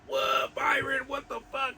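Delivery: high, incredulous cry of disbelief; shocked friends. Retold or imitated. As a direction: play the friends in total disbelief. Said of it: "they were like".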